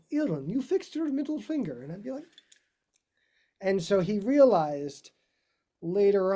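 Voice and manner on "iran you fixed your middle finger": higher, put-on Russian accent for the teacher's scolding. Russian teacher Dima fretting over a student's finger, in a Russian accent. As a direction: imitate a fussy Russian violin teacher in a Russian accent. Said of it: "Dima would go".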